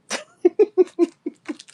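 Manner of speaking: high, loud laughter; real laugh. Real, not acted. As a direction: burst out laughing at your own advice. Amused after her warning.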